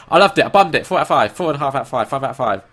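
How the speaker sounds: loud, gushing fan; gushing excitement. Retold or imitated. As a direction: rave about it, loudly. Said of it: "And you go".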